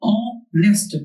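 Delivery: ऑनेस्ट in two beats, ऑ, then नेस्ट; ऑनेस्ट sounded out as ऑ - नेस्ट; split into syllables. Shown as a demonstration. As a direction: leave a gap after ऑ in ऑनेस्ट. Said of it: "ऑनेस्ट को दो बार में बोल".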